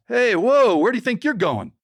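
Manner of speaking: called out high and loud, a sharp hey; loud playful hail; mock shout. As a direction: stop them as they leave with a loud hail. Mechanic calls out to leaving guests.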